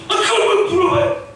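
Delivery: exasperated retort. Acted. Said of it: "그럼 제가 막 그래".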